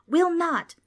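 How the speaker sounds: defiant retort. Acted. Trix argues back.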